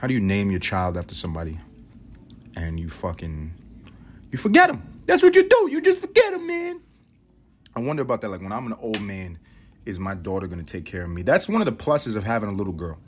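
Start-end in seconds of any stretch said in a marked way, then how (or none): 4.3-6.8 high, loud mocking voice. Father mocking himself for forgetting grandma's birthday, high. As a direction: sneer it in a silly high voice.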